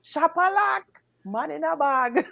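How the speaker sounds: lightly imitated broken-English chant; a hint of the man-in-the-bag voice; Jamaican Chinese man. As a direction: repeat his cry, drawing out each phrase. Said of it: "China man don't speak good English".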